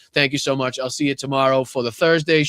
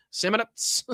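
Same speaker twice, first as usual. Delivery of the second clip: "Samanats" in a high, silly voice; childish playfulness; 8 semitones higher, slower than usual. Shown as a demonstration. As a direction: savour "Samanats" high-pitched like a giddy child. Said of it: "What a child I am".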